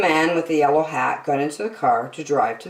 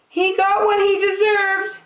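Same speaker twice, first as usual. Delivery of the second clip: high, huffy woman voice; woman's voice. A narrator's reading. Woman scolding about her ruined apartment. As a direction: sniff it out indignantly. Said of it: "said the woman".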